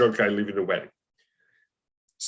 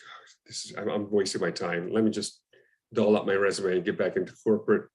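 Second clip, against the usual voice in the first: low, subdued, defeated muttering; distraught; 5 semitones lower, 4 dB quieter than usual. Retold or imitated. Actor riding the bus home after rejection, quietly distraught. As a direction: retell sinking into discouragement, voice dropped low. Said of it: "I'm just distraught".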